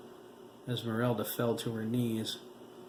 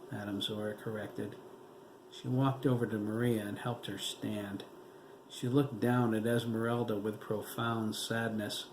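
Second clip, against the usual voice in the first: ordinary delivery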